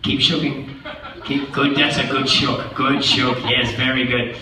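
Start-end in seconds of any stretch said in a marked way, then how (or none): none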